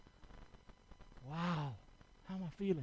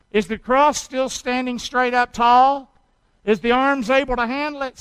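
ordinary talk

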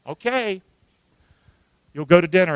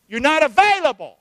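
acted accusation; 6 semitones higher, 6 dB louder than usual. Acted wife-husband quarrel.

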